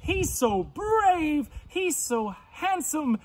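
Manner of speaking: high squeals easing into a pleading wail; acted frightened scream. Missus Pig sees a monster at her door, theatrically scared. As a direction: shriek and fret like a storybook pig. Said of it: "she was so scared, she screamed".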